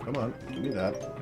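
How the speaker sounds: ordinary talk, nothing marked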